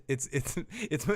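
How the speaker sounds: ordinary talk, nothing marked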